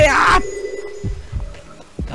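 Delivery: long, high breathy shriek; panicked scream. Real, not acted. 迫る敵に悲鳴を上げる. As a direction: scream as danger hits.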